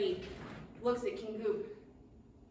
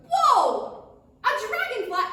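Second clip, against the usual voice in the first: surprised; 9 semitones higher, 10 dB louder, slower than usual. Acted. Serenity startled by dragonfly.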